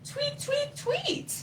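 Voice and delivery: very high, soft peeping; tiny chick peeps; baby bird tweet. Acted. Baby bird number three hoping mommy finds the tree, high peeps. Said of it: "said baby bird number three".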